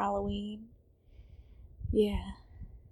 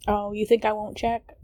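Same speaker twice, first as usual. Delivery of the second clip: ordinary talk, nothing marked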